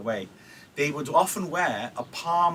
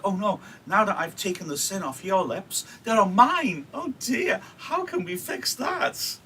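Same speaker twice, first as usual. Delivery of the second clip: hammed-up mock alarm, higher and higher; teasing Juliet; 8 semitones higher than usual. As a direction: play up her fake dismay, climbing higher. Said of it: "teasing Mama".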